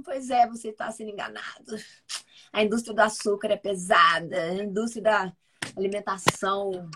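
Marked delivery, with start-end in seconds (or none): none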